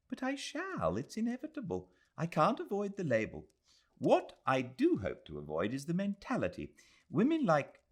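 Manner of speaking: put-on a worried young woman thinking aloud; young Englishwoman. Adela confessing her fear to Aziz, earnest.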